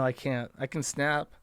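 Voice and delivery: ordinary delivery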